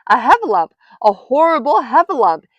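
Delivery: high, loud cry of terror; alarmed cry. A narrator's reading. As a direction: raise the alarm as terrified Piglet. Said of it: "cried Piglet".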